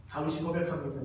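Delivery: usual speaking voice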